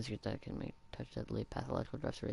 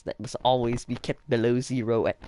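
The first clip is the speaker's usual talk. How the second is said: loud, faltering Austrian-accent attempt; shaky-success mock Austrian accent; Arnold Schwarzenegger; 11 dB louder than usual. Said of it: "I can't do Arnold Schwarzenegger".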